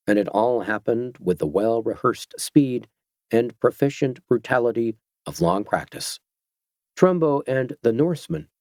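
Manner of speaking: ordinary delivery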